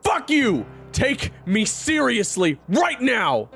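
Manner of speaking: high, loud angry yell; enraged demand at full voice; furious shout. Acted. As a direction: hammer each word out slowly, yelling. Enraged Raven demands a fight.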